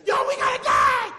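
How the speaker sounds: a real yell caught up in preaching. Real, not acted. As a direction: shout it out to the congregation, can't hold it back. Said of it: "Why am I yelling".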